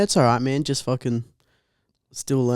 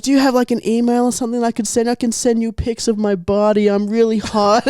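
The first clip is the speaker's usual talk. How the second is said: put-on ditzy girl voice; 9 semitones higher than usual.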